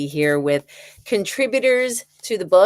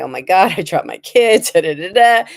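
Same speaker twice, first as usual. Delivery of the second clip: panicked, laughing; 7 dB louder than usual. Retold or imitated. Retelling panic after dropping baby.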